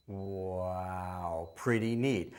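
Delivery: low, hushed, drawn-out wow of wonder; amazed wow. Shown as a demonstration. Showing a child how to react to the beep, hushed amazed wow. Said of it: "I go".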